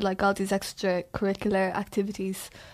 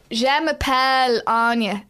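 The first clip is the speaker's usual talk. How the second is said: put-on loud, drawn-out French in an Irish accent; French with no attempt at the accent, Irish through and through; 4 semitones higher, 6 dB louder, slower than usual. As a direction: mimic a pupil saying French with a plain Irish accent. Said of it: "speakking French in class in an Irish accent".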